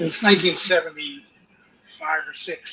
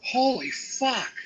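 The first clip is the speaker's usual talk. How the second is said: higher, slowed stunned curse; awed curse; 8 semitones higher, much slower than usual. Retold or imitated. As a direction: drag it out in disbelief. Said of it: "I go".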